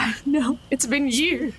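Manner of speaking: high, laughing admission; sheepish laugh while owning up. Real, not acted. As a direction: giggle through the excuse. Laughing at dropped dice.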